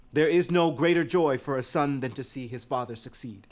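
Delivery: normal talking voice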